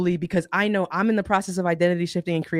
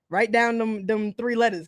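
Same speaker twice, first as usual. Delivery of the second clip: ordinary talk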